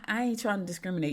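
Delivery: ordinary talk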